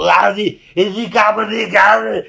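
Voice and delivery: put-on loud, high-strained Austrian-accented gibberish; mock Schwarzenegger grumble-yell. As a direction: grumble loudly like a hammed-up action star. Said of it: "I turn into Schwarzenegger".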